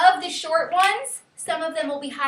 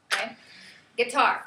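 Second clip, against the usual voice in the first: ordinary talk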